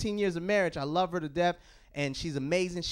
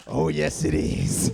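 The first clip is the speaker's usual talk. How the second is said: much lower, breathy, sultry reply; seductive whisper; 14 semitones lower, much slower than usual. Pretend. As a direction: seduce the bill collector. Phone-sex bit to collector.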